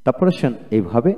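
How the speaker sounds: ordinary talk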